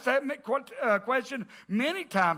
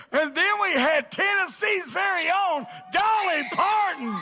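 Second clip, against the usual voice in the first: excited shout; 5 semitones higher than usual. Real, not acted. Excited announcement to congregation.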